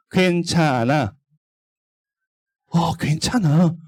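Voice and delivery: slow, coaxing little voice; coaxing Satan. Acted. Teacher voicing Satan tempting children, slow and coaxing. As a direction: lure them in gently, slowly. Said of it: "마음속으로 막 속삭여요".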